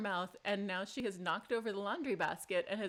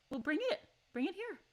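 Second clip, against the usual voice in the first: high cooing call to the kitten; coaxing pet; 11 semitones higher than usual. Real, not acted. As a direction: sweetly invite the cat over. Coaxing kitten to bring toy.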